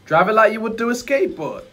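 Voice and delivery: laid-back street-wise genie; cool guru voice. Acted. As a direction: play it smooth and relaxed, a genie with swagger. Acted guru lines.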